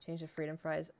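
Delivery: ordinary talk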